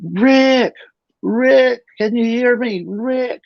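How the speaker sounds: high, faraway call of his name; distant voice calling. Retold or imitated. Voice calling to the man in a coma, high and distant. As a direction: call out gently as if from far off. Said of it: "I heard this voice going".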